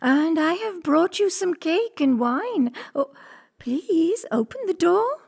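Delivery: high put-on girl voice; mock little-girl request; wolf posing as little girl. A narrator's reading. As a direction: plead in a high, girlish disguise.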